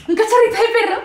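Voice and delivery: high, loud squeal of delight; puppy excitement. Acted. Acts out seeing a puppy. (emoción aguda ante un cachorrito)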